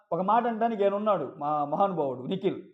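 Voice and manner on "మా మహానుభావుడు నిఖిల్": ordinary delivery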